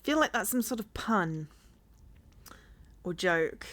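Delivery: ordinary delivery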